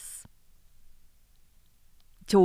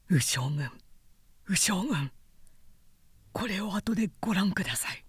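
soft, hushed and quick, barely voiced; furtive messenger whispering in the dark; secret whisper; 6 dB quieter than usual. A narrator's reading. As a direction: slip him the words unseen.

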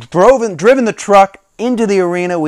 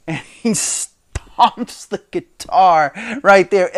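ordinary delivery

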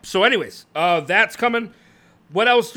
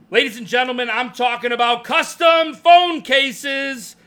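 announcer voice; 7 semitones higher, 5 dB louder than usual. Acted. Hyped product reveal to viewers.

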